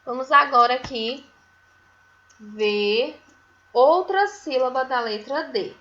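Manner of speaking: normal talking voice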